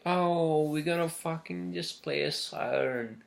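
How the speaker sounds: hammed-up imitation of people shrugging off a missile; mock Japanese people. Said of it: "the Japanese people are like".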